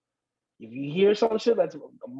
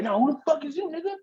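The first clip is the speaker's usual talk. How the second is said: higher, confronting demand; imitating an aggressive challenge; 9 semitones higher, faster than usual. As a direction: get in his face. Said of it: "He's like".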